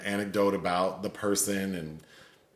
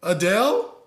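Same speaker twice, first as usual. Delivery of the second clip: mock tearful guess; 9 semitones higher, 5 dB louder than usual. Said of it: "And then the girl goes".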